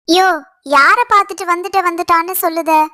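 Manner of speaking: ordinary delivery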